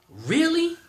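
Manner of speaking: high, loud "Really?"; shocked disbelief; real outraged surprise; incredulous. Real, not acted. Outraged at bank refusal.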